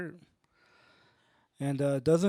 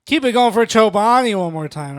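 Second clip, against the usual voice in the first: loud, higher crowd-rousing call; hype-man shout; 5 semitones higher, 12 dB louder than usual. Acted. Comic rousing the crowd for Chobani, loud hype-man shout. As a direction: whip up applause at full volume.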